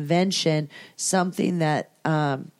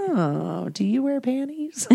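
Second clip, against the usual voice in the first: flirty teasing voice; 6 semitones higher, 4 dB quieter, slower than usual. Retold or imitated. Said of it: "And it's like".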